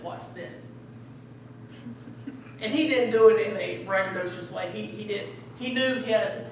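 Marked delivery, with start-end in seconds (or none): 0.0-0.8 hushed, breathy "Watch this."; quiet confident Jesus. Retold or imitated. Jesus telling the scribes before healing, hushed knowing confidence. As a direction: let it drop to a knowing hush. Jesus about to heal the man.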